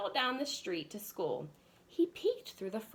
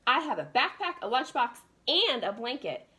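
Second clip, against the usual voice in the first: eager, louder and higher; excited; 4 semitones higher, 6 dB louder than usual. Acted. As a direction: burst in bursting with eagerness. Rufus bursting in excitedly.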